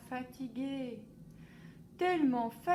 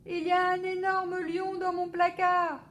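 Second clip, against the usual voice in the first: high loud alarmed report; boy shouting about the lion to papa; child shouting; 8 semitones higher, 9 dB louder than usual. A narrator's reading. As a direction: insist there's a lion. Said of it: "crie Alphonse".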